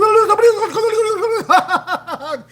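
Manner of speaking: high, loud gibberish with a laugh; mock rapid Dominican babble. Mimicking how Dominicans sound when wound up, loud high babble. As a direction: imitate a fast wound-up talker, blurring it. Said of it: "'Cause they do".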